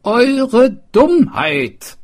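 very slow, high and loud retort; angry retort. A narrator's reading. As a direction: spit it out slowly. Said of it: "rief der Schulmeister".

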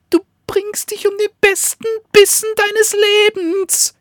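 high, loud yapping little dog. Acted. Little dog yaps at the wolf, high and loud. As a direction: yap it out, almost crying. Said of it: "kläffte das Hündchen".

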